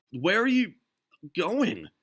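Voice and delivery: Ducky puzzled as Derville leaves; puzzled call. A narrator's reading. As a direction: call after her, baffled. Ducky calling after Derville.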